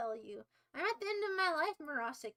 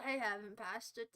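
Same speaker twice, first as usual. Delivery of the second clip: usual speaking voice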